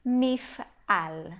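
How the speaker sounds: "mif'al" slowly, split mif-al; demonstrated reading. Shown as a demonstration. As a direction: sound out "mif'al" in two separate syllables. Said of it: "nós temos aqui". ("mif'al" devagar, sílaba por sílaba)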